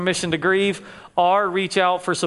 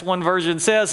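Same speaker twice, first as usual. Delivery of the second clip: ordinary talk, nothing marked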